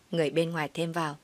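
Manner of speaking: ordinary delivery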